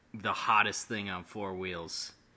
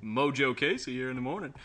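radio DJ voice. Pretend. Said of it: "I've been working on that".

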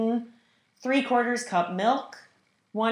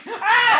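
startled laughing shriek; 13 semitones higher, 8 dB louder than usual. Real, not acted. Egg cracking goes wrong.

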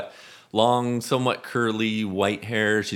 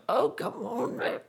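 put-on high, softer old-woman grumble; muttering old lady; 4 dB quieter than usual. Old lady struggling to lift something, muttering. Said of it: "kinda muttering to herself".

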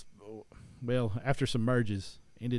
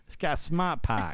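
put-on slow, louder Boston accent with the r dropped; 6 semitones higher, 8 dB louder, slower than usual. Still joking in Boston accent for the host. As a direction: stretch it out in Boston fashion. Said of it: "I can do the".

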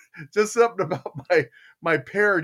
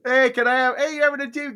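high, sweet wheedling; hopeful dad; 8 semitones higher, faster than usual. Retold or imitated. Dad trying daughter's tea party again, hopeful. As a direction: ask in a raised, hopeful voice.

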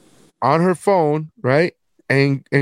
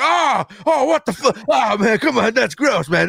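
high-pitched imitation of appalled outcry; mock freak-out; 8 semitones higher than usual. Family reacting to his answer at the table, mock freak-out. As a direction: act out everyone recoiling in shock. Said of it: "everybody immediately freak out".

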